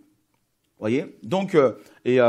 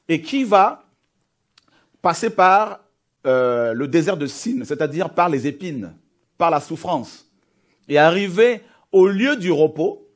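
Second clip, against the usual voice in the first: building preacher's cadence; rising preaching; 3 semitones higher, 5 dB louder than usual. Acted. Preacher telling the congregation of the exodus, building intensity. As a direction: lift the crowd toward the promise.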